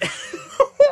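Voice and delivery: very high, loud burst of laughter; shrieking laugh. Real, not acted. Co-host cracks up at a milk pun with a shrieking laugh.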